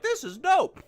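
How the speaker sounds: high, loud delighted exclaim; excited. Retold or imitated. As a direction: gush like a thrilled fan. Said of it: "and I was like".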